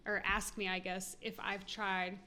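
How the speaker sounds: ordinary delivery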